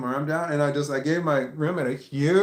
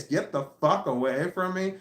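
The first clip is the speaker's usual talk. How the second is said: normal talking voice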